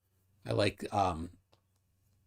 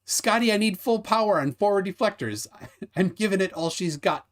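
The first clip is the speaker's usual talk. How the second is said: lightly dramatised starship command, higher pitched; mock starship command; 7 semitones higher than usual. Host reads a viewer's Star Trek line, mock starship command. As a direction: read it out like an urgent call to the engineer, lightly hammed up.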